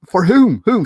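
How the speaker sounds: blurted, exasperated mock questioning; Harley demanding answers. Voices Harley demanding dying man finish.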